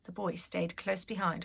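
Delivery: ordinary delivery, nothing marked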